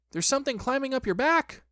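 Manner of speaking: high-pitched alarmed warning; crying out. A narrator's reading. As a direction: warn him urgently in a squeak. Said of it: "he cried".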